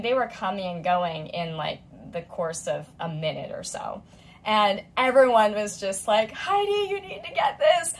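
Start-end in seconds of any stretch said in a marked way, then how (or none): 6.3-7.9 high, eager urging; bright mock urging from friends; excited group chatter. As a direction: voice the group egging her on. Group members urging her online.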